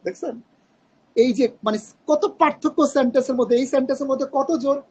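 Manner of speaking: ordinary talk, nothing marked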